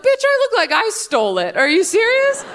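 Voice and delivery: high, incredulous retort. Retold or imitated. Telling off a friend, high and incredulous. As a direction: scoff at the friend's silly question.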